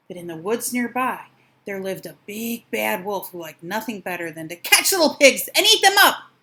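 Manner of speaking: plain reading voice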